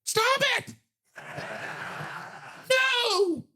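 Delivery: very high, exasperated yell; comic outburst. Real, not acted. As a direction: yell at the absurdity, laughing on "No".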